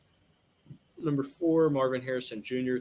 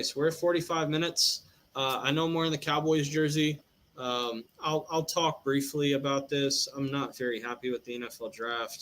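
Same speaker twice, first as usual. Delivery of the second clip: ordinary talk, nothing marked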